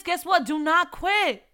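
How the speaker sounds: ordinary talk, nothing marked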